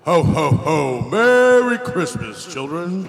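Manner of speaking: loud, slow jolly Santa; booming Merry Christmas. Pretend. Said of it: "let me do mine".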